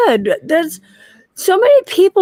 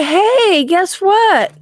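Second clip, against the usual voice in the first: higher, sing-out mock greeting; lightly acted, higher-pitched teaser; playful pitch; 6 semitones higher, much slower than usual. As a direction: pretend to spring news on a friend. Rehearsing a request to an acquaintance.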